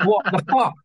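high-pitched retold disbelief; exasperated re-enactment, pitch jumped up; incredulous outrage. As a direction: exclaim it high, utterly baffled. Retold outrage at tour manager's refusal.

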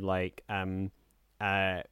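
ordinary talk

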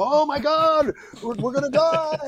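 high, hammed-up cry of doom; mock panic. Actor on set faking terror during the attack, mock panic. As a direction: play it like the ship is going down, half joking. Acting the ship under attack.

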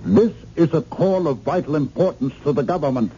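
high-pitched, agitated insistence; urgent insistence. Acted. Government caller insists to operator the call is vital, agitated. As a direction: impress the importance on them, voice pitched high.

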